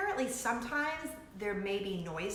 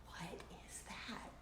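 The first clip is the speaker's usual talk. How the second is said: very soft, slow whisper; aghast whisper; 2 semitones lower, 21 dB quieter than usual. Acted. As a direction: whisper it slowly in disbelief. Aghast aside after her howl.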